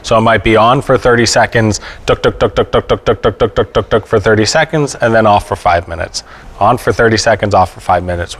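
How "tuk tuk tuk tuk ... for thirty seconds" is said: mimicked electric pulse. Shown as a demonstration. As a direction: tap out the beat of the stimulator. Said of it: "It sends a regular pulse of electricity".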